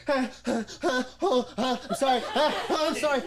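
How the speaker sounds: loud, high comic sobbing; hammed-up wailing apology; mock bawling. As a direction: imitate a bawling girl apologizing between sobs. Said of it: "bawling. She's like".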